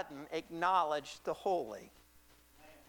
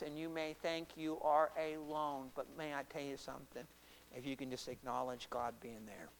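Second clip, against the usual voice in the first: low, softened, measured appeal; solemn preaching; 4 semitones lower than usual. Acted. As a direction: bring it down to earnest reassurance.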